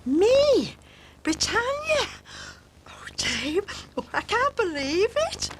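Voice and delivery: high-pitched thrilled squeal; gush, breathless at the honour; overjoyed disbelief. Acted. Julia offered the part of Britannia by Dave, overjoyed disbelief.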